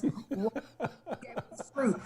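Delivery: quiet, slow, laughing words; chuckling softly at her own point. Real, not acted. Doctor laughs softly about God giving us fruit.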